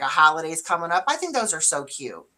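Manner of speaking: ordinary talk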